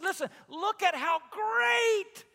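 loud, slow, high call to look; excited call. Retold or imitated. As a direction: urge them to look in wonder. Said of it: "He said".

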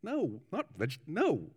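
softly affronted New Zealand minister; slightly offended. Acted. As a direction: reject the guess, mildly put out. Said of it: "slightly offended".

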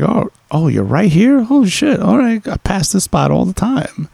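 high, lively imitation of an excited guy; excited quote. Imitating the neighbour, high and excited, on seeing the spot. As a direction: bounce it up like you just found a cool place.

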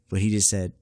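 ordinary delivery

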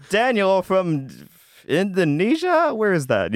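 drawn-out, high-pitched incredulous question; puzzled American kids. Retold or imitated. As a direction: stretch the words high in mock bafflement. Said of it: "they're all, like, East Coast kids".

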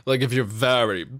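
hammed-up very British, rolled R on British; exaggerated British accent, slowing at the end; mock British accent. Shown as a demonstration. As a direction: put on a plummy British accent, stretching R's. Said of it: "the British roll their R's".

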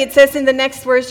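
ordinary talk, nothing marked

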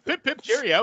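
high, chirpy mock-British cheerio. Friends joking about the orderly, mock British cheerio. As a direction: toss off a silly posh English farewell.

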